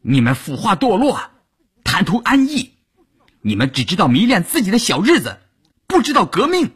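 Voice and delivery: high-pitched, heated denunciation; angry yelling. A narrator's reading. Bai Shufen denouncing the couple, yelling angrily. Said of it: "白淑芬气得大叫".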